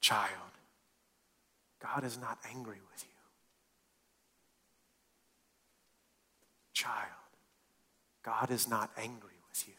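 whispered reassurance for the congregation; quiet tender whisper. Shown as a demonstration. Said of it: "repeat that silently in your own heart with me".

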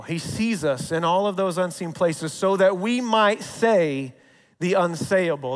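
usual speaking voice

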